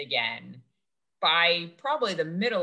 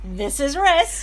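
sing-song intro; 6 semitones higher, 8 dB louder, slower than usual. Acted. Podcast host intro, name drawn out.

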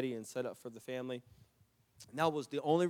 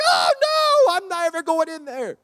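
scared refusal; 14 dB louder than usual. Retold or imitated. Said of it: "Jennifer's like".

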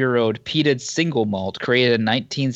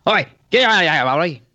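higher, louder Pikey shout; Pikey accent; 6 semitones higher, 4 dB louder than usual. Pretend. Guard at the barrels chases off intruders, Pikey accent. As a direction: channel a film Pikey accent, chasing someone off. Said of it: "do Pikey".

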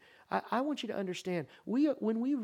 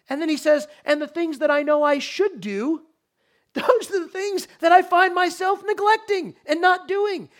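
loud, high, driving sermon voice; full-force preaching; 10 semitones higher, 14 dB louder than usual. Acted. Pastor to congregation on Israel, preaching at full force. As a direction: preach it out to the whole sanctuary.

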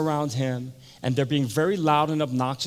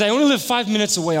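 high, loud, hurried promise; eager burst; 8 semitones higher, 8 dB louder, faster than usual. Retold or imitated. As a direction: blurt it out excitedly. Acted Agnes eager to go.

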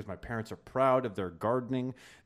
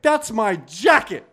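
high, loud and slow accusation; angry outburst; 12 semitones higher, 13 dB louder, slower than usual. Retold or imitated. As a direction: hit him with it, high and loud. Confronts Jamie wearing his jacket.

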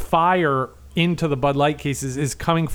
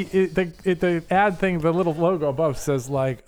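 normal talking voice

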